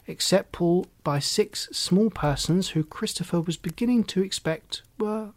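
ordinary delivery, nothing marked

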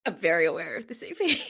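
embarrassed, laughing reply. Real, not acted. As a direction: answer through a rising giggle. Embarrassed artist teased about own EP.

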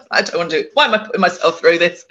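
high burst of laughter, then words through a laugh; laughing at herself. Real, not acted. Medium recalling platform nerves, laughing at herself. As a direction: laugh it off, embarrassed.

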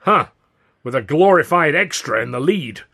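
loud, scornful complaint; grunted scorn. A narrator's reading. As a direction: scoff at the offer. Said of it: "Considine grunted".

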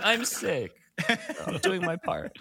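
high squeaky laughing whine; laughing mock sick voice. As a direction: giggle through a fake sick excuse. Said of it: "I'm like".

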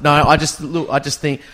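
ordinary delivery

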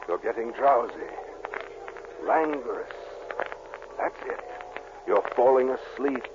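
low, fading hypnotic drone; hypnotist's lull. Acted. Doctor hypnotizing Dennis again, low lulling voice. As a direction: draw him under softly.

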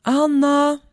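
acted husband's call. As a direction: call out to someone in the next room. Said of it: "han ropar".

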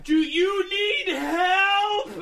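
very slow, high, loud shout; as if they're deaf, drag out every word. Retold or imitated. Speaker mimics talking to someone without English, slow exaggerated shouting. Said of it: "slower and harder".

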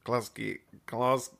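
very soft, low practice run; thinking it out. Shown as a demonstration. Host rehearsing Glasgow accent, thinking it out softly. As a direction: murmur the accent to yourself, testing it. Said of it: "I'm gonna think about it".

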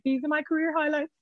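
high, mock-proud announcement; mock proud mum. Mum boasting to her daughter about the washing line, mock proud. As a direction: show off the washing like a trophy. Mum's deadpan joke quoted.

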